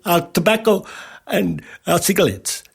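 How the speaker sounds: put-on Indian accent. Newsagency owner asking for tobacco advertising, in an imitated Indian accent. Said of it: "Sorry about the accent".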